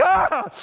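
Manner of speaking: sudden high yelp of sting pain; cry of pain. Retold or imitated. Pastor describing ocean sting, mock yelp. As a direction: yelp like salt hit a cut. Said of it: "and then you're like".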